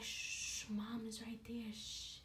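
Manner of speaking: hushed whispering. Retold or imitated. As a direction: whisper urgently to hush someone. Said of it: "he was just like".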